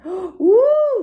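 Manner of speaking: high, loud delighted gasp then woo. A narrator's reading. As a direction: sound amazed at the dancing dolls. Dolls leap out.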